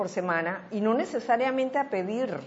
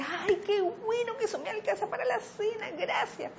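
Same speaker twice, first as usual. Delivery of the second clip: grateful man; 13 semitones higher, 4 dB quieter than usual. Retold or imitated. Retold grateful poor man.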